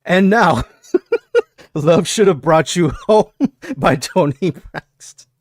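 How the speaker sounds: mock DJ intro through giggles; DJ announce breaking into laughter. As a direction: announce the song, cracking up. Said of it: "Let me set the mood".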